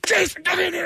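high frantic yell to the next room; calling out. Retold or imitated. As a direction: summon a buddy in a panic. Calls friend from next room.

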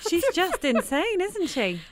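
high, slow amazed exclamation; gushing in disbelief; excited. Real, not acted. Host reacts to interview clip.

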